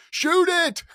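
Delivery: very high, loud sideline shout; yelling. Retold or imitated. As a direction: holler it across a hushed crowd.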